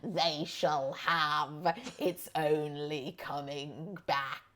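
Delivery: slow, ominous villain pronouncement; doom-laden prophecy. Retold or imitated. Mocking John about summer solstice, slow ominous proclamation. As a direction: intone it like a dark sorceress. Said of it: "Like this".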